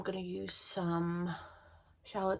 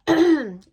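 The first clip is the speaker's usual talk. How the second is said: throat clearing; 7 semitones higher, 18 dB louder than usual. Real, not acted. Sick vlogger clears throat.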